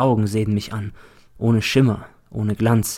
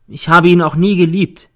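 louder, firmer denial; a bit louder; 7 semitones higher, 7 dB louder than usual. A narrator's reading. Eva insists before the court, noticeably louder. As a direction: raise her voice a step. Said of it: "sagt sie etwas lauter".

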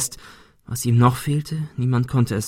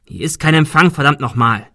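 loud, irritated swearing; cross and loud; scolding; 4 semitones higher, 6 dB louder than usual. A narrator's reading. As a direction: curse in exasperation, voice raised. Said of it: "schimpfte Arthur".

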